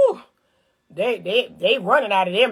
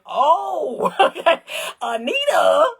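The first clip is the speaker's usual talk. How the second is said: higher, slow, laughing mock man's realization; mock dawning voice with a laugh, higher; 6 semitones higher, slower than usual. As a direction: play the man finally getting it, amused.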